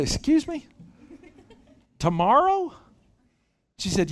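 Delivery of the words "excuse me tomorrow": surprised disbelief. Retold or imitated. Wife assigns sudden errand.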